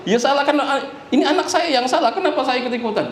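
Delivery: high-pitched, indignant surprise, acted out; retold startled objection, pitched well up; startled protest. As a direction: imitate a shocked father protesting his innocence. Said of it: "Oh, kaget".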